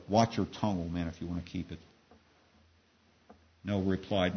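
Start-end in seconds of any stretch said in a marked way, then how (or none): 0.1-1.8 understated threat, dropping away; threatening. A narrator's reading. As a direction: warn him, getting lower and softer. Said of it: "Bukiah threatened Noah".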